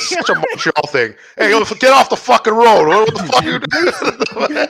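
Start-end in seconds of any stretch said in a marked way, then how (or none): 1.4-4.7 mock road-rage yell, louder and higher; angry driver. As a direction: yell like a fed-up driver at a jaywalker. Acting out honking Montreal drivers.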